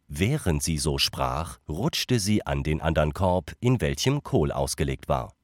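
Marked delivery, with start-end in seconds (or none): none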